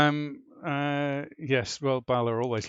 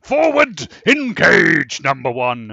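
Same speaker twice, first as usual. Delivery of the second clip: louder, higher, grand captain voice; Picard impression; 4 semitones higher, 14 dB louder than usual. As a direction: command the bridge with pompous gravity. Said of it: "thick Shakespearean accent".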